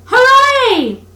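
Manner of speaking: high, loud cheer; joyful shout. A narrator's reading. Rat shouting for joy at his find in the snow. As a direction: whoop with triumph. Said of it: "Suddenly the Rat cried".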